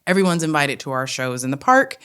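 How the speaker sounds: ordinary delivery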